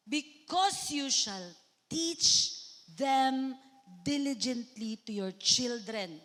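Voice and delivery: slow, deliberate reading of a Bible verse; scripture reading. Acted. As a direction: read the verse out slowly, weighing each word. (hinay nga pagbasa sa bersikulo)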